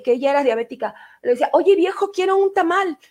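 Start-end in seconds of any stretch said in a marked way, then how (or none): 1.5-3.0 mom's request. Retold or imitated. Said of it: "decía mi mamá".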